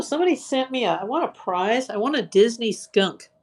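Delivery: ordinary talk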